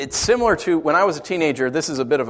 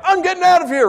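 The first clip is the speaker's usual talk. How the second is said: much higher, louder squeaky cat voice; cat's panic; 7 dB louder, faster than usual. Retold or imitated. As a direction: voice the frantic cat, hammed up. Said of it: "the cat's like".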